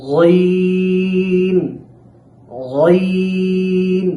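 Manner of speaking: long sung Ghain, held steady; Arabic letter demo. Shown as a demonstration. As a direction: chant গইন from the back of the throat. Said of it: "তারপর আমরা শিখি". (গলার শেষ থেকে টেনে গইন)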